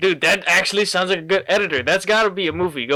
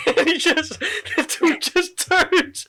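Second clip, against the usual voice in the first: high laughing narration; giggling through the story; 14 semitones higher, slower than usual. Real, not acted. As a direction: tell it while cracking up. Laughing while describing meme.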